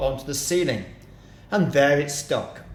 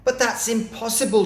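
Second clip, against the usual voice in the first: incredulous cry; 8 semitones higher, 3 dB louder than usual. A narrator's reading. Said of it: "cried one of the small monkeys".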